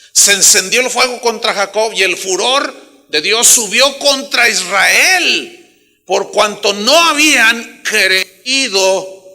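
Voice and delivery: loud, slowing Bible reading; fiery scripture reading. Acted. Pastor reads God's wrath aloud, fiery scripture reading. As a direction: proclaim the verse like judgment.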